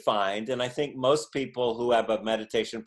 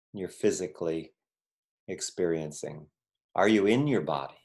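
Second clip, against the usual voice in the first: guided meditation; 4 semitones lower than usual. Acted. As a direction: guide them gently into the body. Leads a short meditation.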